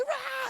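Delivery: short, high mock screech; imitated toddler scream. Dad imitating his son as it's taken away, mock scream. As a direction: imitate a child's protesting shriek. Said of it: "and he'd scream".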